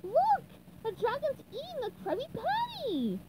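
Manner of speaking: high excited cry of discovery; exclaiming. Acted. SpongeBob sees the dragon eating, excited cry. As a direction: point and cry out in surprise. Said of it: "exclaimed SpongeBob".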